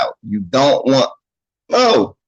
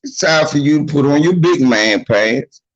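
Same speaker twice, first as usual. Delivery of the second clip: ordinary talk, nothing marked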